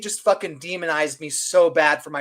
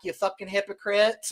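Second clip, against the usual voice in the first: normal talking voice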